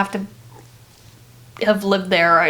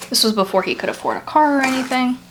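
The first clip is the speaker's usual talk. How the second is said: usual speaking voice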